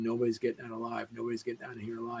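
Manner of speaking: low, hushed, rapid mumble; muttering. Retold or imitated. Imitating a train passenger talking to himself, quick low muttering. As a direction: mutter it quickly to yourself, under your breath. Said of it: "kept muttering to himself".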